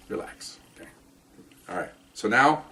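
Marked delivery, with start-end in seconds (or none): 0.1-0.9 very soft, hushed mantra; self-calming whisper. Retold or imitated. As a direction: breathe it to yourself, barely voiced. Calming himself down.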